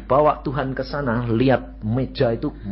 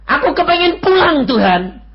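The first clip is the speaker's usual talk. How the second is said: high, loud begging; man begging Jesus to let him stay, loud; pleading cry; 10 dB louder than usual. Retold or imitated. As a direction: plead to go home.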